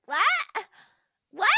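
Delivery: high, loud, incredulous "what?"; stunned disbelief. Retold or imitated. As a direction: blurt it out in mock shock. Acting out stunned reaction to insult.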